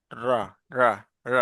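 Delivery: র said with the tongue curled back; curled r demo. Shown as a demonstration. Teacher showing students the curled-tongue র sound. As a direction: roll the tongue back for each র. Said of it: "আমার দিকে দেখো".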